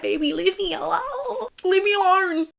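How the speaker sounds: fed up, waving the show away; exasperated. Real, not acted. Annoyed at the show on Hulu.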